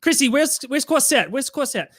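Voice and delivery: high worried calling out; Adriana calling. Retold or imitated. Adriana asks Chrissy where the dog is, high anxious call. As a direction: pitch it up, searching for the dog. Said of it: "Adriana comes out and is like".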